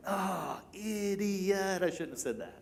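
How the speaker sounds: soft regretful groan to oneself; quiet self-reproach. Acted. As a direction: kick yourself under your breath. Instant regret after blurting something.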